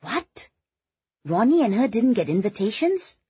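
high, incredulous questioning; astonished. A narrator's reading. Friend hearing Nadine wasn't invited, high-pitched surprise. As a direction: react in disbelief to the gossip.

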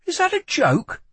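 high-pitched, aghast question. A narrator's reading. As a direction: ask it in aghast disbelief, pitched right up. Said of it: "Lila stared at him aghast".

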